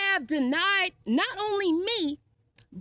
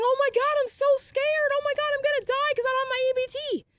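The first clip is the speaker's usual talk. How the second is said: higher, fast mock panic; sarcastic fake fear over losing the EBT card; mock fretting; 7 semitones higher than usual. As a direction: ham up a pretend fright, sarcastically. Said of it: "And I'm supposed to be fretting".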